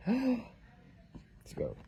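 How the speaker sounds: high gasp into eager words; excited gasp. Real, not acted. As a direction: sound thrilled to turn the page. Reading to baby, gasp.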